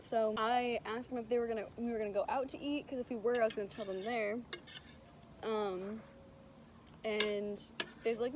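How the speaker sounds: ordinary talk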